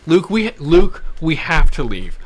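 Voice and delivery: urgent retold plea. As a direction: insist he has to go now. Urging friend to leave fast.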